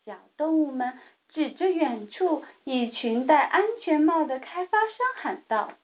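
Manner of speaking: plain reading voice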